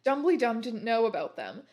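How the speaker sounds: plain reading voice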